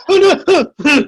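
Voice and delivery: high, loud mock panic. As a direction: yelp it in fake alarm. Imitating friend fleeing.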